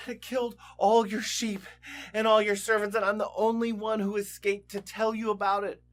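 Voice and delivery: loud, panting alarm calling Job; a messenger bursting in to warn Job, shouting breathlessly. Acted. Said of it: "he's all out of breath".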